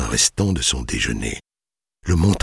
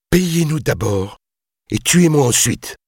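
defiant; 14 semitones higher, 3 dB louder than usual. A narrator's reading. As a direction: throw down the challenge despite fear. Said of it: "lança-t-il". (défi lancé, voix haute)

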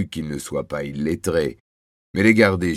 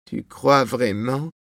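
slow, higher-pitched wondering question; doubtful question; 6 semitones higher, slower than usual. A narrator's reading. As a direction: ask it slowly, wanting to believe. Worried wife doubts her husband. (question dubitative, lente et aiguë)

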